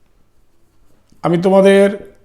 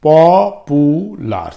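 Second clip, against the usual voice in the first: Popular drawn out slowly, syllable by syllable; slow syllable demo; 5 dB louder, much slower than usual. Shown as a demonstration. Teacher to students counting syllables, slow drawn-out popular. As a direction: stretch popular slowly so each syllable is heard. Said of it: "তিনটে".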